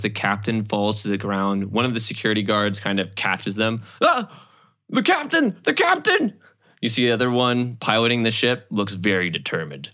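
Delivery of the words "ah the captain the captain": high-pitched mock cry of alarm; alarmed guard. Security guard catching the fallen captain, high alarmed cry. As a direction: cry out high as your leader drops.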